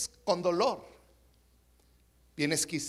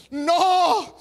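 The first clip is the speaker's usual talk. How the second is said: loud, high, long-held mock cry; frightened drunk; 7 semitones higher, 8 dB louder than usual. Drunk to the pastor after the dunking, mock frightened. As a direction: play a scared drunk wailing his answer. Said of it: "el borrachito asustado".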